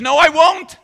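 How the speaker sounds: very high, loud refusal; Peter defiant. Retold or imitated. Preacher voicing Peter's stubborn refusal, high and loud. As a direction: dig in and refuse. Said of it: "and he says".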